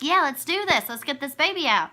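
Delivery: high-pitched, upbeat rallying cry; retold eagerness. Mother retelling her epidural high before pushing, excited. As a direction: re-enact being pumped up and raring to go. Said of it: "So I'm feeling great".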